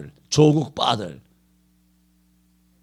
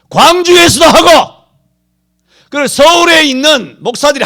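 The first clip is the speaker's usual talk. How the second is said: loud, high, impassioned sermon; thundering pulpit rant, much higher and louder; fiery preaching; 7 semitones higher, 10 dB louder than usual. Acted. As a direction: preach it out at full force to the congregation. Preaching at full force.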